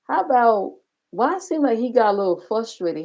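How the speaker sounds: ordinary talk, nothing marked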